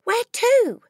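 put-on very high, loud child's question; alarmed cry. A narrator's reading. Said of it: "Matilda cried out".

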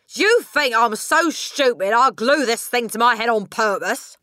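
loud, high, indignant rant; Mister Wormwood shouting. A narrator's reading. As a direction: shout in outrage at being blamed. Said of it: "Mister Wormwood shouted".

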